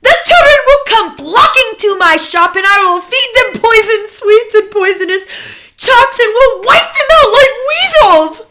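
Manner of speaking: high, loud gleeful witch cry; shouted. A narrator's reading. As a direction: blurt out the plan in wild excitement. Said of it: "leapt to her feet and shouted".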